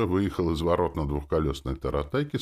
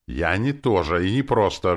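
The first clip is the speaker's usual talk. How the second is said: loud, raised indignant growl; menacing growl; 5 semitones higher, 7 dB louder than usual. A narrator's reading. Demoness correcting her host's insult, menacing. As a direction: roar it with menace. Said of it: "грозно прорычала Настёна".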